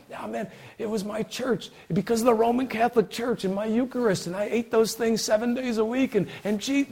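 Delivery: high-pitched imitation of a proud churchgoer; mock gushing boast. Acts out misguided church boast.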